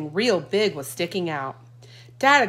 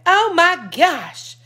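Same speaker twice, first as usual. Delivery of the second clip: dismayed; 10 semitones higher, 7 dB louder, much slower than usual. A narrator's reading. Mama dismayed.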